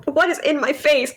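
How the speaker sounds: ordinary talk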